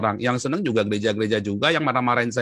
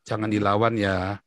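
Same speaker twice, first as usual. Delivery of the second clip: lower, slow, calming father; gently restrain the child, slowly; imitated fatherly counsel; 4 semitones lower, slower than usual. Father telling his bullied son not to fight, calm counsel.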